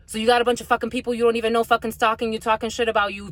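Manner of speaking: ordinary talk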